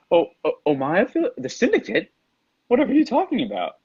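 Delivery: high, mock-innocent villain voice; laughing villain. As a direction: feign ignorance in a high, amused lilt. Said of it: "he laughs and he says".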